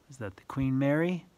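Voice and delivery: ordinary delivery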